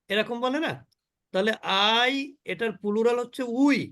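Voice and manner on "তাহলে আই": ordinary talk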